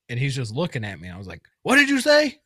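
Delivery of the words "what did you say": loud high yelled question; retold yelling. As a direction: yell it from inside a helmet, high and loud. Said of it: "I'm, like, yelling".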